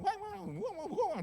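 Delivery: high mimicked ranting; mock her angry high-pitched tirade; ranting woman. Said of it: "Tapi itu perempuan". (tiruan perempuan mengomel dengan nada tinggi)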